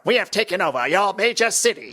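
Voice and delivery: high alien invader voice; high-pitched mock villain announcement; Exilian imitation. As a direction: proclaim it as a campy alien conqueror. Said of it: "It's got the Exilian guy".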